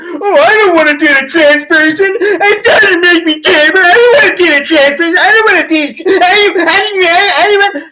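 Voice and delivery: high, loud, fake-sobbing whine; mock crying whine. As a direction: wail it like a crybaby making excuses. Mocking whiny straight guy.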